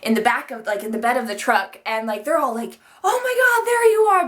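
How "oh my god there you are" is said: much higher, louder mock outcry; retold frantic relief. Family spots the missing girls from the truck, mock frantic cry. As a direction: imitate a frantic family finding the missing kids. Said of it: "and they were freaking out".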